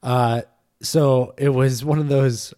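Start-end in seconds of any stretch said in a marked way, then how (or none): none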